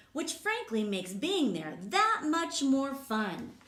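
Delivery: lively, sing-song read-aloud voice; reading to children. Acted. Teacher reading a rhyming book to kids, lively. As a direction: bounce the rhymes for the class.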